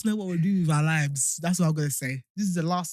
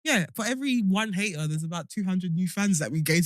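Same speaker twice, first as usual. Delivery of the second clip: normal talking voice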